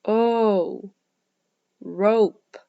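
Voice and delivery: rope with a drawn-out long O; long O demo. Shown as a demonstration. Teacher showing kids the long O in rope. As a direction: stretch the O in rope like oh. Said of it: "This word has the long O sound".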